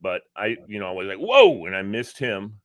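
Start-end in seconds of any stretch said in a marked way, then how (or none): 1.2-1.6 startled whoa. Retold or imitated. Sudden swerve near motor cop.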